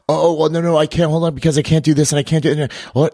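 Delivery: high-pitched rush of flustered protests; acted-out flustered objection; mock panicked excuses. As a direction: imitate newcomers balking, high and hurried. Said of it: "immediately faces dropping".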